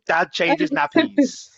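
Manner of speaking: loud, high, drawn-out joke headline; mock news headline. Host teasing the couple about family amazement, mock headline announcement. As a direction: proclaim it like astonishing breaking news. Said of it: "It's like a news article".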